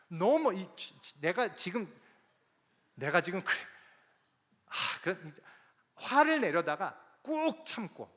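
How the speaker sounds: a retold fit of rage swallowed down; barely held fury. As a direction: choke on the anger, slow and stammering. Missionary's rage held back.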